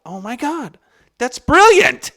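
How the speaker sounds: high exclamation building to loud; retold awestruck outburst. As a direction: erupt in mock revelation, reliving it. Retold amazement peaks.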